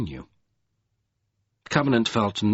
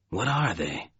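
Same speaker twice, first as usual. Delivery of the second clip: softer, quiet question with falling end; murmured question; 6 dB quieter than usual. A narrator's reading. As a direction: ask it under your breath. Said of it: "he murmured".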